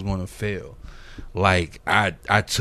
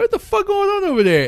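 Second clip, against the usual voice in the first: much higher, loud stunned cry; retold disbelief; 5 dB louder than usual. Watching a friend's video, high stunned cry. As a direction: shriek it in amazed confusion. Retelling shocked reaction.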